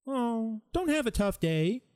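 high, drawn-out cooing mock comfort; hollow sympathy. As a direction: imitate a useless consoler.

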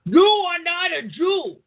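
high, slow mock yell; imitating an angry man yelling it; mock angry yell at the protesters. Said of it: "yelling profanities and saying".

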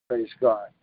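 normal talking voice

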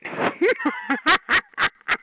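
very high squealing cackle; gleeful laugh. Real, not acted. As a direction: cackle at your own threat. Laughing after mock threat on phone.

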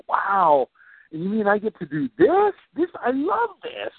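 breathless wow, then high thrilled disbelief; amazed delight. Pretend. Coach voicing the client amazed at her success, high and thrilled. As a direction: sound like you can't believe your luck.

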